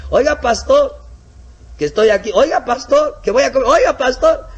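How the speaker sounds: high, loud nagging calls; pestering congregant. Retold or imitated. Eager disciple pesters the pastor at mealtime, high loud nagging. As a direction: keep calling the pastor, needy.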